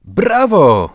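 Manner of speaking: loud, high bravo; echoing cheer. A narrator's reading. Zbyszek cheering the father's goal, loud and high. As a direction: join in the cheer at full voice. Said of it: "zawtórował mu Zbyszek".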